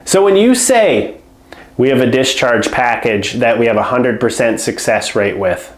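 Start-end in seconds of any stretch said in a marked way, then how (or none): 1.8-5.7 low, flat, dropping pitch with no lift; flat low tonality. Shown as a demonstration. To a home care client, the weak pitch: low and flat. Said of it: "You see the difference in tonality there?".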